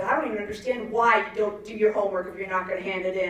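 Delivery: ordinary talk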